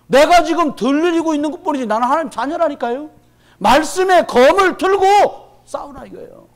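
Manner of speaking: loud, high, impassioned sermon delivery; fervent preaching. Acted. Pastor urging the congregation toward the shoes of peace, fervent loud preaching. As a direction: preach it out, rising with fire.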